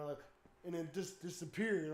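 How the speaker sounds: usual speaking voice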